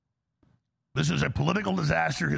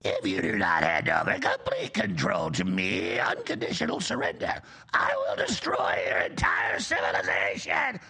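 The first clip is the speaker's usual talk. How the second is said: Cobra Commander; 11 semitones lower than usual. Retold or imitated. Pundit mocks the president as Cobra Commander, low villain voice. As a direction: menace them like a cartoon commander demanding surrender. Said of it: "Or like Cobra Commander".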